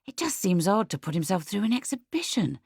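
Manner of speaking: ordinary delivery, nothing marked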